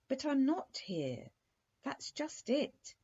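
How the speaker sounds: high exclamation, drawn out at the end; exclaiming. A narrator's reading. Prince telling the princess he isn't there, exclaiming. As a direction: exclaim it with the pitch flung up. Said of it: "exclaimed Prince Amaryllis".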